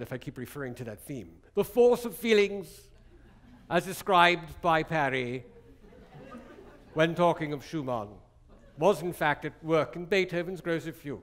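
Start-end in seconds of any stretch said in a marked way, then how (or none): 1.5-2.7 louder, higher, grandly declaimed English lecture voice; pompous English lecturer. Acted. Lecturer mocking English lecture style for students, pompous and louder. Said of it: "lecturing in a, a church in London". 3.7-11.2 pompous English lecturer. Acted. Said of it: "Do you like that kind of lecture?".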